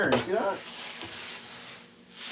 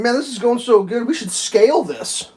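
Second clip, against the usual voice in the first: mock fraudster; 7 semitones higher, 5 dB louder than usual. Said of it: "They're like".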